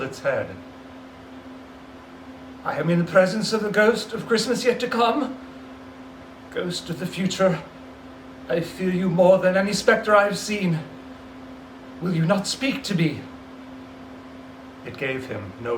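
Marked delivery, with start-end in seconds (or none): 2.6-13.2 lightly voiced frightened Scrooge pleading; a hint of old Scrooge, awed and uneasy; Scrooge, fearful. Scrooge begging the silent phantom, mild fear.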